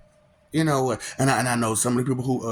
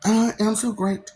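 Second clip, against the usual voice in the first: a hint of a bratty cartoon kid; lightly voiced cartoon boy, slow; mock Springfield brat; Bart Simpson; 6 semitones higher, much slower than usual. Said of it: "Bart Simpson".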